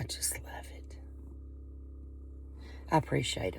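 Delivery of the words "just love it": soft breathy whisper. Real, not acted. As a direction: breathe it tenderly. Tender aside about the cats.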